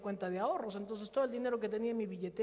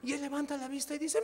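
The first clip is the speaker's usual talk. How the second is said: ordinary talk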